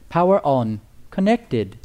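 imitation of a British lady speaker announcement; British-accented lady voice prompt; nice lady voice. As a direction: lightly mimic a pleasant British woman's device prompt. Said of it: "in this nice lady voice".

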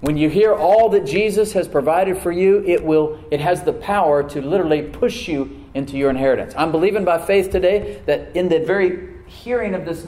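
loud, urgent proclamation; forceful preaching. Real, not acted. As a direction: declare it to the congregation with conviction.

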